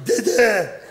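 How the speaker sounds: Kıvanç Tatlıtuğ impression. Shown as a demonstration. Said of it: "Bak yapayım bak".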